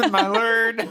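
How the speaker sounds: high, laughing echo; laughing mockery. Real, not acted. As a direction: repeat it while laughing.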